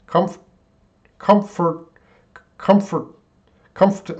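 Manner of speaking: "comfort" as a separate high, falling fragment; trying it slowly. Shown as a demonstration. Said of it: "I can't say it slow".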